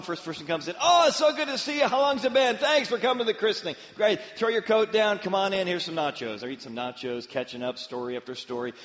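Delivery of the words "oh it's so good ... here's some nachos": much higher, louder gushing hostess greeting; excited hostess. Retold or imitated. Hostess welcoming party guests, high and loud excited. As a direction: gush over every guest at the door.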